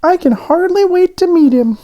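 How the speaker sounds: very high, eager little donut voice. A narrator's reading. Arnie the donut on the tray, excited and high. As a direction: squeak it out with childlike excitement.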